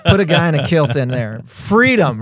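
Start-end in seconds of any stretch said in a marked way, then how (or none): 1.5-2.2 loud Scottish battle cry; Braveheart Freedom yell. Pretend. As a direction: shout it like Braveheart. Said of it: "put a Scottish accent in it too".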